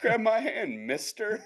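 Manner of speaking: put-on high-pitched little kid voice; child voice. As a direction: play a helpful little kid. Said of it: "six-year-old is just like".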